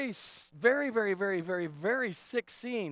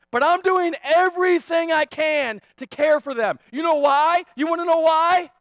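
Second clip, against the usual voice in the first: loud, high and impassioned; full-force preaching; 10 semitones higher, 13 dB louder than usual. Real, not acted. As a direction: build to an insistent question.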